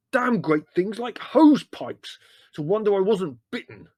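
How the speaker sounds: high, loud outraged voice; indignant bellowing; furious ranting. A narrator's reading. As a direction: rage about it at full voice. Leslie still raging about snakes.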